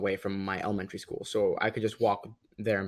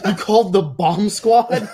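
incredulous; 10 semitones higher, 11 dB louder, slower than usual. Real, not acted. Incredulous punchline to the listeners.

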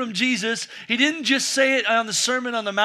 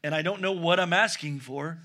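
sincere apology; 4 semitones lower, 3 dB quieter than usual. Real, not acted. Apologizes to wife before congregation.

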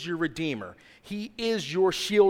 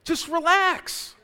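soothing; 13 semitones higher, 6 dB louder, much slower than usual. Acted. Voicing God, soothing.

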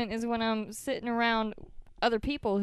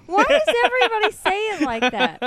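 high, loud indignant protest; loud exasperation; 11 semitones higher, 9 dB louder than usual. Real, not acted. Woman teased about her home state, loudly exasperated. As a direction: demand an answer, exasperated.

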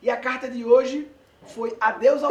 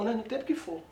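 ordinary delivery

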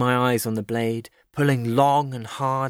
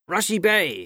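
high-pitched urgent call; commanding cry; 9 semitones higher than usual. A narrator's reading. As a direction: cry it out like a crew chief. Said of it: "he cried".

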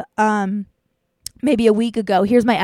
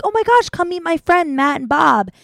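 gushing; 7 semitones higher, 4 dB louder than usual. Retold or imitated. Mock overly friendly guy.

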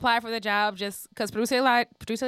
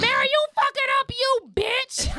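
squealed much higher, like a hyped fan; mock diva shout. Imitating the crowd at Essence Fest, high squeal. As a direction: scream it up high at Mary. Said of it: "I was like".

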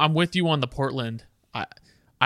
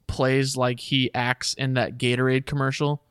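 usual speaking voice

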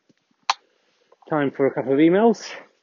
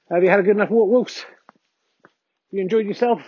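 high, sing-song voice for the dog; doggy voice; 7 semitones higher, 5 dB louder than usual. Real, not acted. Owner coos up high at his dog Wolfie after the walk. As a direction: coo up at the dog like a pet owner.

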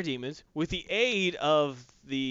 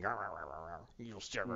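very low, much softer growly mutter; grumbling mumble; 9 semitones lower, 14 dB quieter than usual. Retold or imitated. Skeletor grumbling back in his lair, low. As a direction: grumble under your breath like a sulking villain. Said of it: "He's mumbling".

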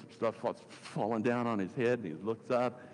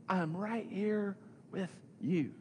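drawn-out, higher-pitched reassurance; slow, deliberate reassurance; 6 semitones higher, 4 dB quieter, slower than usual. Retold or imitated. Preacher repeats Jesus' promise to the discouraged, slow and deliberate. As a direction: spell it out slowly, with pauses between phrases.

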